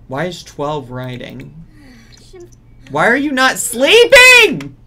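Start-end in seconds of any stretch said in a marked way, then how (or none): 2.9-4.8 much higher, loud yell; exasperated shout. Real, not acted. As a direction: scream the question in frustration. Yelling at Sim awake.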